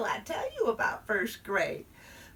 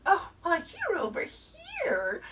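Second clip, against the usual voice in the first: singsong teacher; 12 semitones higher than usual. Acted. Teacher voiced pointing out seat.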